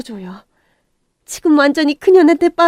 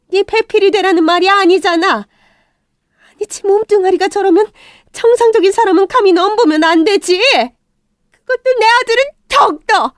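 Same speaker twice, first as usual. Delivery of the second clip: 크고 높게 쏘아붙이는 말투; 단호하게 몰아세우듯; 5 semitones higher, 4 dB louder than usual. Acted. Mother rebukes son. (아들에게 반박하는 엄마, 화난 훈계)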